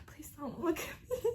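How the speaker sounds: unvoiced whisper, pleading under the breath; whispered plea. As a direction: beg in a whisper so mom won't notice. Silently begging mom not to look.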